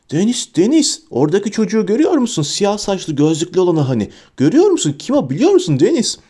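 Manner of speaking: high-pitched, eager rush of questions; excited. A narrator's reading. Boy pointing out the famous kid to Deniz, excited. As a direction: pester eagerly, voice jumping up.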